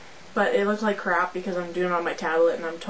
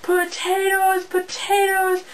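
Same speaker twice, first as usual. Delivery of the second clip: high, slow, drawn-out screams; screaming; 10 semitones higher, slower than usual. Retold or imitated. Hyper kid screaming the word out of class. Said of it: "I was screaming".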